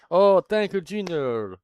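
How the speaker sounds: delighted squeal of thanks; excited thanks. Real, not acted. Streamer thanking a viewer who just arrived, excited squeal.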